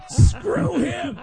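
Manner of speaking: put-on very high, jeering drunk heckler; mock drunk heckle; heckling fan. Drunk crowd jeering retold.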